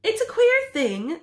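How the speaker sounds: high, slow musing; wondering. Acted. Husband muses to wife about penguins, wondering. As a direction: marvel at it aloud.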